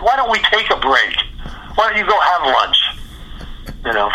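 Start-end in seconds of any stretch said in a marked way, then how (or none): 0.0-2.7 high-pitched pushy suggestion; a little attitude. Retold or imitated. Scared New York guitarist to the conductor, a little attitude. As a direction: answer back with some cheek, voice raised in pitch. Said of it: "I had a little attitude".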